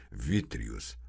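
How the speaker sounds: ordinary delivery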